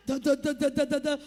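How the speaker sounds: mouthing a drum beat; steady mouth rhythm; imitated music beat. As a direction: tap out the rhythm by voice.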